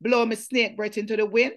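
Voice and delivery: poem reading. Acted. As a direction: declaim the poem with intensity. Reads her own Creole poem.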